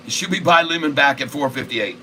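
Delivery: normal talking voice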